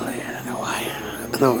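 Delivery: whispering through tears; choked up. Real, not acted. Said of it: "I'm getting so emotional".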